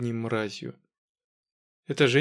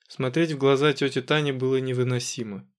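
ordinary delivery

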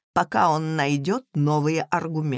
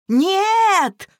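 much higher, louder shout; shouted in chorus; 12 semitones higher, 6 dB louder than usual. Acted. Three children shouting their answer to their mother. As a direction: yell it out together. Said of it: "хором выкрикнули все трое".

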